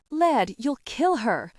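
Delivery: alarmed warning. Acted. Onlooker warning the brutal laird.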